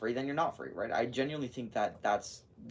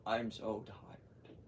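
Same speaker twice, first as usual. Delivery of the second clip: weary; 6 dB quieter, much slower than usual. Real, not acted.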